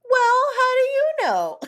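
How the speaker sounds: very high, loud fake indignation; mock offended. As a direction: squawk back as if insulted. Mock offence at co-host's remark.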